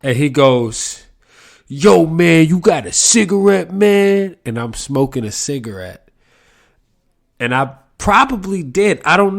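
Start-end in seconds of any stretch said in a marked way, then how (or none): 1.7-4.3 higher, louder street drawl asking for a smoke; homeless guy's voice. Retold or imitated. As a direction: ask for a cigarette like a pushy guy on the corner. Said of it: "this is how he talked".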